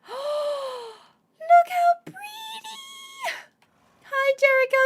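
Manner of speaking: very high, slow squeal of joy; very high, drawn-out look how pretty; delighted squeal. Real, not acted. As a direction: gush over the horse you love. Finds beloved horse.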